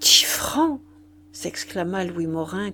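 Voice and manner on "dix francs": high, aghast outcry; dismayed exclamation. A narrator's reading. Morin hearing the vicar's price, aghast. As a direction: blurt it out in consternation. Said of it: "consterné".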